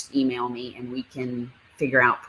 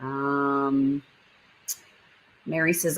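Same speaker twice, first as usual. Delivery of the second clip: ordinary delivery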